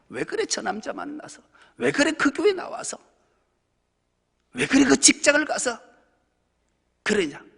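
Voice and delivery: loud, teasing scold rising high; mock scolding Jesus. Jesus mock-scolding the self-blamer, loud and teasing. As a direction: scold like someone smacking the back of a head. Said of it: "뒤통수 때리는 분이".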